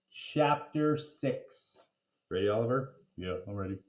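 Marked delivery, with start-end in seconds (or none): none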